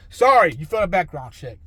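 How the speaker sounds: loud mock-official rejection; retold blunt rejection. Background check result given to applicant, loud rejection. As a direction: deliver the bad news loudly, punching the first word.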